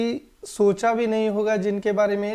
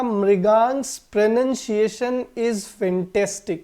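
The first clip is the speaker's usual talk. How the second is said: slow example sentence; much slower than usual. Shown as a demonstration. Teacher reading the example sentence aloud slowly. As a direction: read out slowly and clearly. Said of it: "मैंने लिखा".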